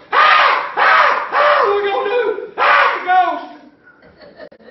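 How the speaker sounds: high, loud mock panic, laughing at the end; hammed-up screaming. Preacher mocking grown men panicking, hammed-up shrieks. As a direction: scream like frightened little girls, playing it for laughs. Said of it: "like a bunch of little girls going".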